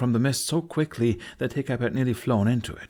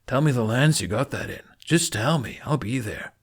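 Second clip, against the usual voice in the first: huffing; 3 semitones higher than usual. A narrator's reading. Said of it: "Toughnut huffed".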